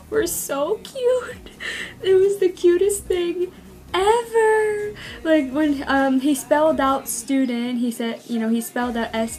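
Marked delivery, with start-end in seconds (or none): 0.1-4.9 high, squealing gush; gushing fan. Real, not acted. Fan gushing over [name]'s English, high squeal. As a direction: squeal over how cute it was.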